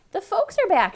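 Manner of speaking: high, loud eager announcement; excited. A narrator's reading. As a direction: call out happily that they're home. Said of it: "she said".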